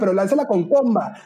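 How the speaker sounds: retold shout, pitch up high; a high-pitched retold call to protesters on the street; mock call-out. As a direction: call it out like advice yelled from a balcony rail. Retelling shouting advice at protesters.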